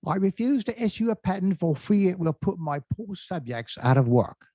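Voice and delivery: high-pitched mock British monarch; bad British accent. Shown as a demonstration. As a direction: put on a hammy British accent. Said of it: "an accent that might give you a hint".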